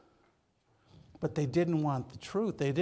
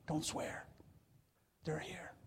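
whispered, much softer warning; hushed warning; 11 dB quieter than usual. Retold or imitated. Patrons warning each other.

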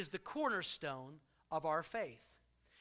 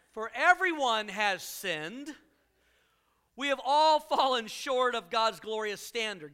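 slow, louder reading of a verse; emphatic scripture read; 4 semitones higher, 8 dB louder, slower than usual. Acted. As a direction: proclaim the verse slowly. Reads scripture aloud.

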